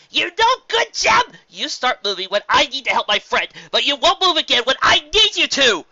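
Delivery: high, loud angry tirade; furious shout. Acted. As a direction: rage at the useless rose. Des yelling at the rose.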